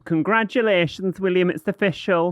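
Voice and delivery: sing-song mimicry of Wendy's text; man doing a woman's voice. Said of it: "I'll do the impressions".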